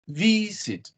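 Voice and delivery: "Visit" with VI high and long, second syllable dropping; stress on first syllable. Shown as a demonstration. Teacher shows where the stress is, "Visit" stressed on VI. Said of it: "in the first syllable".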